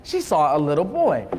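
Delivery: slow, storybook read-aloud; unhurried picture-book narration; reading to children. Acted. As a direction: draw the kids into the story, slowly.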